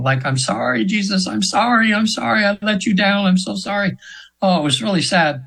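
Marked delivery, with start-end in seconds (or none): 0.2-4.0 mild retold screaming. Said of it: "she's screaming".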